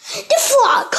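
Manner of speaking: high, loud announcing cry; exclaimed title. A narrator's reading. Narrator announcing the chapter title, loud excited announcement.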